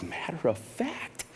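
slow, very quiet tease; hushed build-up. Retold or imitated. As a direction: hold back softly before the punchline. Sets up his punchline answer.

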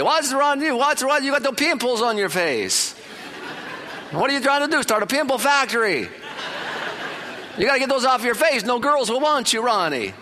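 high, loud mock Italian uncle teasing; loud Italian relatives. Italian relatives loudly mocking little Ronnie's nose, hammed-up. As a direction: tease the boy like a yelling Italian relative. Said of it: "They say".

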